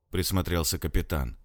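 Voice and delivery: ordinary delivery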